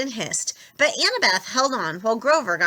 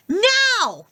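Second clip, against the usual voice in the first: high, loud urgent yell; 13 semitones higher, 5 dB louder than usual. A narrator's reading. Annabeth yells at everyone to flee, high and loud. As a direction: shout them off the bus. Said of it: "Annabeth yelled".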